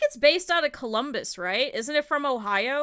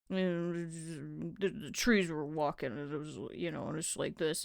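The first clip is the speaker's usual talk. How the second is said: much lower, softer dreamy dad voice; imitating dad; 9 semitones lower, 12 dB quieter than usual. Imitating her strange dad rambling to her friend. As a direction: murmur like a spaced-out dad.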